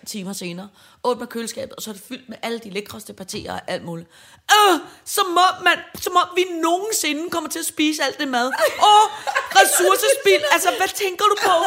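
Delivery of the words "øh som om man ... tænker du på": mock sister ranting about wasted food; outraged teen. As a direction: scold like a fed-up teenager. Sister quoted scolding again.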